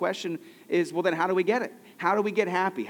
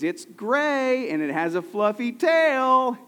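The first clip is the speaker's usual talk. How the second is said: high, louder sweet teacher voice, slow on the tail; coaxing first graders, higher and louder; 7 semitones higher, 5 dB louder, slower than usual. Retold or imitated. Sunday school teacher coaxing the children, high sing-song voice. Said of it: "She goes".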